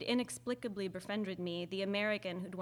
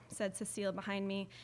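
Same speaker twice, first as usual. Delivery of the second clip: ordinary delivery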